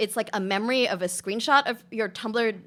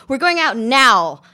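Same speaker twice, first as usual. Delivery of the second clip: loud, slow, firm flatmate order; a flatmate ordering you out, loud and slow; bossy announcement; 3 semitones higher, 10 dB louder, slower than usual. Acted. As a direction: drag her out of bed with your voice. Flatmate drags you from bed.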